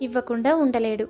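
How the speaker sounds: usual speaking voice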